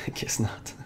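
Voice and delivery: soft laughing whisper. Real, not acted. Laughing echo of host.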